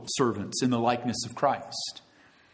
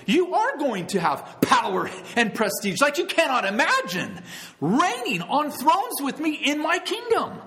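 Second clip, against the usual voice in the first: much higher, ringing promise; lifted preaching voice; 10 semitones higher than usual. Acted. Preacher to congregation, voicing Jesus in a high ringing tone.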